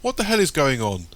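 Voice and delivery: high, loud alarmed yell; young Michael yelling in shock; shocked shout. A narrator's reading. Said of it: "Michael, shocked, shouts".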